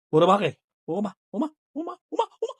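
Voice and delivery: high laughing squeals of o ma. Real, not acted. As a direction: squeal and laugh as the pet grabs you. Pet attacks her playfully.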